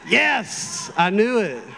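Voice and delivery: high burst of acted delight; mock triumph. Praying young man thinks God approved the girl, triumphant. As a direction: celebrate a sign you badly wanted.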